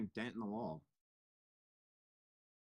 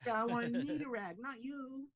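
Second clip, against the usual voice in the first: much higher, squeaky mock voice; hammed-up whiny driver impression; 14 semitones higher, slower than usual. Mocking the driver's excuse.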